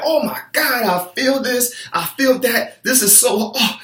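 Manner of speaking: high, strained, out-of-breath panic; panicked gasping complaint. Retold or imitated. Worn-out client gasping to coach Quincy, high panicked whine. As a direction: whine like your lungs are giving out mid-workout. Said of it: "your whole body could be on fire and you're dying".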